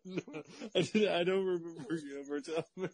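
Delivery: real laughing talk. Real, not acted. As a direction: laugh through the disbelief. Laughing at the grandma story.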